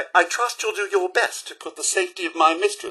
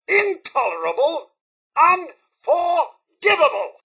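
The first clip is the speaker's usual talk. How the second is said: indignant condemnation. Acted. Butler refusing to follow over innocent blood, in mounting indignation.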